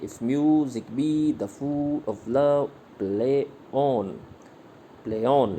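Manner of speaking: slow, with "mu", "food", "love" and "play on" stressed; metered recitation. Shown as a demonstration. Teacher recites Shakespeare in iambic meter. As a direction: recite it line by line, with feeling. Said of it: "इस तरह से बोलेंगे".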